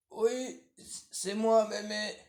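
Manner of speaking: wolf pretending to be the granddaughter; wolf faking girl. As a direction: coax like a sly wolf playing innocent.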